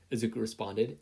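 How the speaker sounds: plain reading voice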